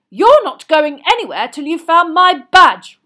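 very loud, high angry order; forceful, furious demand; yelled. A narrator's reading. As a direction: yell it in outrage. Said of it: "yelled Percy".

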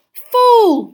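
loud high exasperated cry; moaned. A narrator's reading. Mr Weasley cries out at Lynch's fall. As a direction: groan at the trick. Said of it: "moaned Mister Weasley".

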